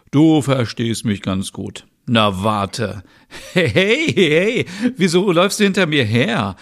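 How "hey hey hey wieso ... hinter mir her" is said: excited laughing protest. Acted. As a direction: squeal while running away. Being chased, laughing protest. (hohes, lautes, lachendes Protestieren)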